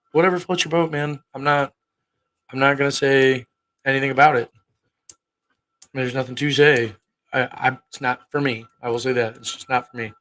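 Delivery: ordinary talk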